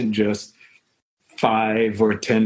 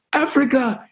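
excited fan imitation. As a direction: greet him like a thrilled fan. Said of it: "a guy look at me and go".

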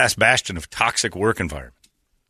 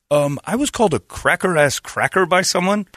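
whiny caller; 9 semitones higher than usual. Acted. Acted caller complaint.

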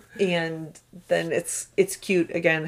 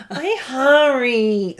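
put-on drawn-out, higher toddler whine; whining hungry child; 6 semitones higher, 4 dB louder, much slower than usual. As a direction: stretch it like a pleading little kid. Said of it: "that's how she says it".

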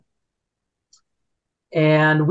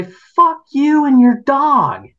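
mock shriek, much higher, slow; retold yell; 10 semitones higher, 4 dB louder, slower than usual. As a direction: imitate the angry woman screaming. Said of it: "they say".

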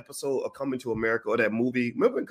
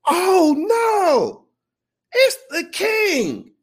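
high, slow squeal of a starstruck fan; excited fan; 7 dB louder, much slower than usual. Retold or imitated. Stadium fan spotting her hero, high squeal. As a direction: gasp it out like she's seen royalty. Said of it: "And she goes".

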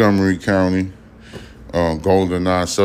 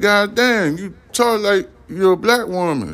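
much higher, astonished God damn; amazed exclamation; 13 semitones higher than usual. Retold or imitated. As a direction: exclaim it in disbelief, pitch way up. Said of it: "And I was like".

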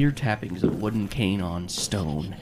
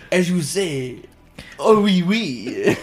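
high, loud mock French; hammed-up French accent, drawing out the last words; 10 semitones higher, 8 dB louder, much slower than usual. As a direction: put on a playful French voice. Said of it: "my French Cajun brother".

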